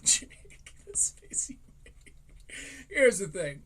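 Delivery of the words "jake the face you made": whisper. Real, not acted. Teasing a co-host's face.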